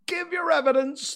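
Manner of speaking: very high, loud angry demand; shrieking royal command. A narrator's reading. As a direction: snap the order as a furious king. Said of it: "the king repeated angrily".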